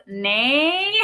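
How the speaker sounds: NAY stretched long with a rising pitch; "nay" as a long, rising joke tag; drawn-out slang. Shown as a demonstration. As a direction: draw out "nay" and lift it upward, teasing. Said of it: "the proper way to say this is".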